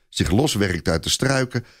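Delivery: usual speaking voice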